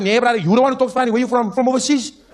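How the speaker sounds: put-on high, loud Cape accent; Cape Town guy. Local demands where the stranger is from, loud Cape accent. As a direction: challenge him loudly in a Cape accent. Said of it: "He's like".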